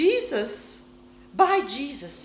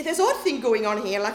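ordinary talk

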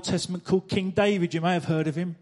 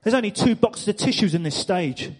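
normal talking voice

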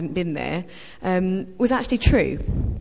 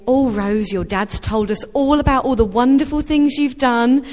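bright gushing praise, higher and louder, no accent attempted; gushing relatives; 5 semitones higher, 7 dB louder than usual. Relatives from Australia greeting Rose, gushing, higher and louder. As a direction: rave about her like a proud aunt. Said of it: "in an Australian accent, of course, which I won't try".

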